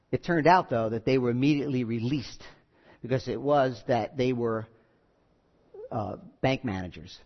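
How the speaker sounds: ordinary talk, nothing marked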